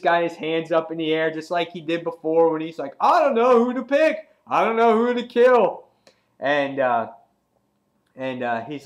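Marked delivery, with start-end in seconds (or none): 3.0-5.8 loud, high theatrical cry; raised, high-pitched hammed-up exclamation; acted villain outburst. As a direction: call it out like a gloating villain. Acting out Negan choosing a victim.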